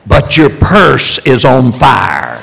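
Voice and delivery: a slow raspy shout; mock diva shout in church; gravelly alarmed shout. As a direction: bark the punchline in the actress's rasp. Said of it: "she said in her gravelly voice".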